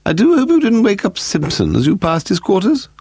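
high-pitched severe reproach. A narrator's reading. Lady Delamore to a late-night guest about waking Simpson, severe. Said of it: "Lady Delamore said severely".

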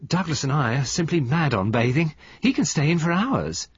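Mrs Gold voiced.

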